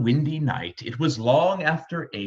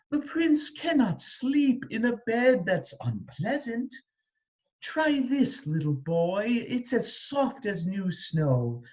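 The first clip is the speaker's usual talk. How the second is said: high, soft soothing voice; 7 semitones higher, 3 dB quieter than usual. A narrator's reading. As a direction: coax gently to sleep. Peasant soothing the prince.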